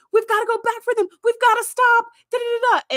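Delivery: imitating Harry's high panicked plea. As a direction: beg to go back in a panic. Harry panics to turn back.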